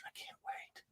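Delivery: barely audible, breathy whisper of anticipation; excited whisper. Real, not acted. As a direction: whisper it like you can hardly contain it. Excited repeat dropped to a whisper.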